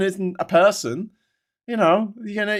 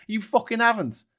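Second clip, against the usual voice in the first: ordinary talk, nothing marked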